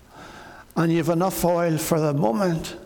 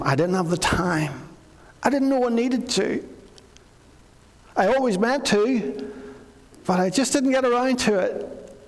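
lightly voiced excuse-maker, higher; a half-hearted feeble excuse, pitched up; excuse-making voice; 5 semitones higher than usual. Preacher voicing the foolish women's excuses, mildly whiny. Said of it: "Jesus heard many excuses".

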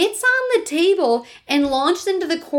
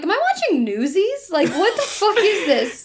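laughing disbelief; 7 semitones higher than usual. Retold or imitated. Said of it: "I'm like".

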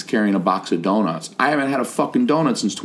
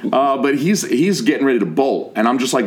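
ordinary talk, nothing marked

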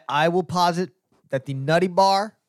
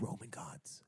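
breathy whisper of delight; hushed, intrigued whisper; whispered ooh; 9 semitones lower, 22 dB quieter than usual. Real, not acted. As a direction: whisper it like a juicy secret. Teasing about Roman gods.

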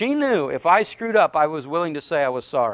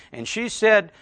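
normal talking voice

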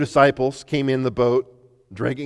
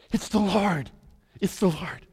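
awed recognition; 8 semitones higher than usual. Acted. John recognizing Jesus.

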